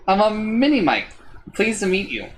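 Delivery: high, light little creature voice; tiny creature. A narrator's reading. Minimite introduces itself politely to the traveler.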